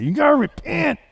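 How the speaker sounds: high, shouted Southern preacher impression; mock a hellfire preacher with a Southern accent. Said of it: "always comes with a Southern accent".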